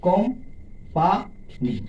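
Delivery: "Company" as কোম, পা, নি with pauses; three slow beats in company; syllable by syllable. Shown as a demonstration. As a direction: separate each syllable of company. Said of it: "তিনটি syllable থাকে".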